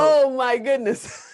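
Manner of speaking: astonished, drawn out into a laugh; stretched-out delighted disbelief; real amazement. Real, not acted. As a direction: marvel slowly, high and laughing. Amazed at the child's hit album.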